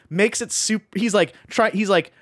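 ordinary talk

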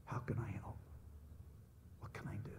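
soft unvoiced, gentle offer; tender whisper. Comforting the hurting neighbor, softly whispered. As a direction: whisper your offer of help. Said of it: "I'm so sorry".

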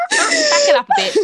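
high-pitched laughing shock; laughing disbelief. Real, not acted. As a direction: squeal through laughter.

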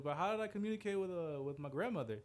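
put-on high, puzzled kid voice; mock kid asking mom; high childish question. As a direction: ask it like a confused child.

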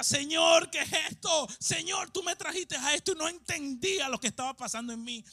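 loud, rising sermon cry; preaching at full force. Acted. Pastor urging the congregation to dream big, loud fervent preaching. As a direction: preach it out to the whole room.

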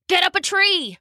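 high urgent order; high urgent call to flee; urgent command. Acted. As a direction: hurry her up the tree.

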